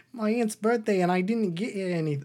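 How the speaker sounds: high mock-Australian lament; hammed-up Australian bear voice; Australian accent. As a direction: wail it lightly in a comic Aussie accent. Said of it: "Now it's just Australian for everybody".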